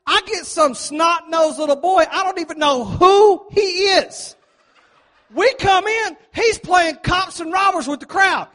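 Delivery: usual speaking voice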